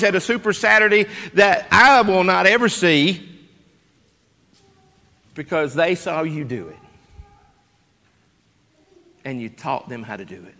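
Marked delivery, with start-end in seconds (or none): 5.3-10.5 much softer, lower, voice thinning with real emotion; getting emotional. Real, not acted. Pastor moved speaking of the departed, emotional and soft. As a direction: let it catch as you feel it. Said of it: "I'm getting emotional".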